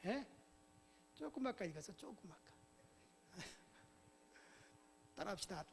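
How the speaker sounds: usual speaking voice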